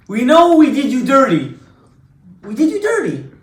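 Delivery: pleading brothers. Retold or imitated. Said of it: "and they said to Yosef".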